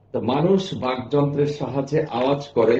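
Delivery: ordinary delivery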